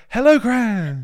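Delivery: high, loud nervous "Hello, Gran"; mock cheery greeting. To the grandmother on the floor, a high nervous hello. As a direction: squeak it out brightly like nothing's wrong.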